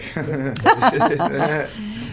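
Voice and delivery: loud, high-pitched burst of laughter; hearty laugh. Real, not acted. Laughs after calling track dope.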